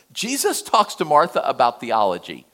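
usual speaking voice

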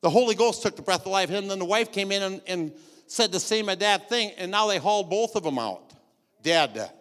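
loud, driving preacher; forceful preaching. Real, not acted. Climax of the preaching.

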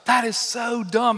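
high, slow, disbelieving; exasperated. Real, not acted. Preacher judging the unforgiving servant, high and exasperated. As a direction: stretch out the frustration. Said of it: "I just think to myself".

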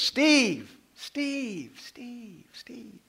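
starts loud, fades into a whisper; from a loud call down to a whisper; fading call. Retold or imitated. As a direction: let each Steve drift further away until only breath is left. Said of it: "Steve starts loud, but the further away it gets".

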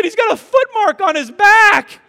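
loud, high mock yell; outraged accusation. Husband mimicking wife accusing him, outraged shout. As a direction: shout the evidence like an indignant mother.

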